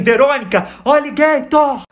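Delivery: loud call, alligator high and stretched out; acted yell. Swimmer warning an American friend in English, yelling. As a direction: holler it across the water in mock panic. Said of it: "gritei assim".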